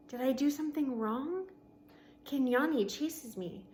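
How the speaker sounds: ordinary delivery, nothing marked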